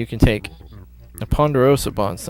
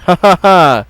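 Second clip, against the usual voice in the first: mock mocking laugh; 7 dB louder than usual. Character laughs at player's name.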